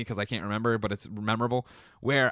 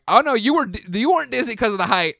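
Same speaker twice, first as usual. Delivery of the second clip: much higher, louder mock alarm; retold panic; 10 semitones higher, 6 dB louder than usual. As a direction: replay the moment fear hit you, hammed up. Retold inner panic at Chanel.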